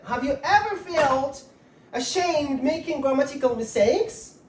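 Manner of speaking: ordinary delivery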